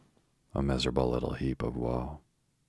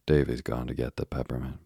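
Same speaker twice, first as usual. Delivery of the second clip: ordinary delivery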